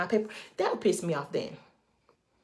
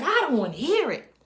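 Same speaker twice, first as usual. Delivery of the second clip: raised, sharp shutdown; angry cut-off; 9 semitones higher, 5 dB louder than usual. Retold or imitated. She cuts off her colleague, loud and angry. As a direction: shut him down loudly. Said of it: "I said".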